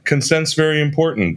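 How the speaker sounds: high, laughing joke. Real, not acted. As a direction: say it while cracking up. Laughing joke about consent.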